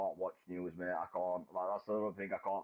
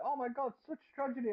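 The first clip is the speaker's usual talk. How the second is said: much higher, put-on tragic cry; mock doom and gloom. Mimicking gloomy news coverage, mock tragic. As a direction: imitate overblown doom in a high voice. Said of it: "it's always the doom and gloom".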